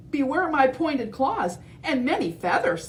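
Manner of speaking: high, lightly voiced bold hen; brave chicken. Edna answering T. rex, high bold chicken. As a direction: strut it proudly.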